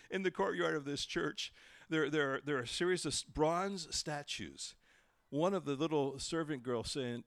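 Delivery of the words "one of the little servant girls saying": normal talking voice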